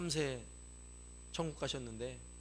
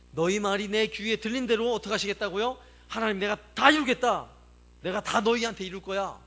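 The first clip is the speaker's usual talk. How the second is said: loud, raised, forceful pronouncement; stern divine declaration; 4 semitones higher, 8 dB louder than usual. Retold or imitated. God answers the complaining Israelites, stern declaration. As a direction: voice God's stern verdict at full volume.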